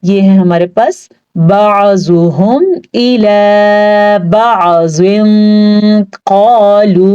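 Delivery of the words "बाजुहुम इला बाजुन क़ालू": slow, drawn-out tajweed chanting; Quranic recitation. Shown as a demonstration. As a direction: recite slowly, stretching each vowel. Recites the verse word. (धीमी तिलावत)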